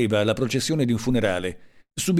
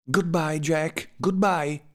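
higher-pitched, impassioned farewell cry; grido appassionato; 7 semitones higher, much slower than usual. A narrator's reading. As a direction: cry out goodbye in a high voice, without extra volume. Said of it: "gridò". (grido d'addio appassionato, acuto)

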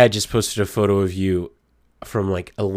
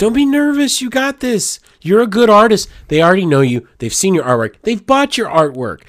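much higher, eager encouragement; excited pep talk; 10 semitones higher, 4 dB louder than usual. Real, not acted. Reassuring a nervous friend, high and excited. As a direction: cheer your friend on, pitch soaring.

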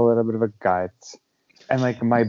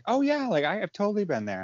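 much higher, bright mock-enthusiastic agreement; eager listener; 9 semitones higher, 5 dB quieter than usual. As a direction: play the keen, engaged friend. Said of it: "someone who's like".

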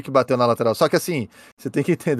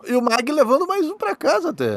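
pitched up high, cheering the name; excited hype; 12 semitones higher than usual. Real, not acted. Hyping a driver's result.

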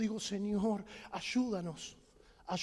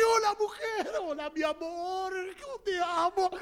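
higher, eager and affectionate; gushing greeting; 6 semitones higher than usual. Acted. Husband greeting his wife, high and gushing. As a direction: overflow with love for her.

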